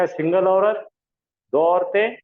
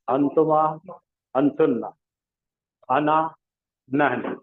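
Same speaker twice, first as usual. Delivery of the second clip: measured drill, each word set apart; recited with gestures. Shown as a demonstration. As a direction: spell them out word by word for the class to follow. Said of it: "इशारतन पढ़ना है".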